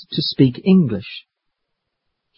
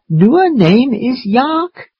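slow, high questioning echo; confused grandfather; 10 semitones higher, slower than usual. Acted. Grandfather echoes Maddie wrongly, slow puzzled question. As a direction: parrot it back slowly, rising in doubt.

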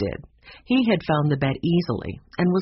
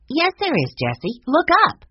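put-on high, insistent girl's exclamation; excited storybook child, dipping on the name then ringing out; excited Violet; 11 semitones higher, 4 dB louder than usual. A narrator's reading. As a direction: point out a find in a high, eager voice. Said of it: "Violet was excited".

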